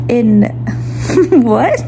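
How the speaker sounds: crying laugh breaking through; a small sob-laugh; teary laugh. Real, not acted. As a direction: laugh through real tears. Tearing up over concert.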